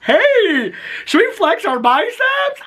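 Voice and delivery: a dopey dad showing off his muscles; mock goofy dad. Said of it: "that dad's always like this".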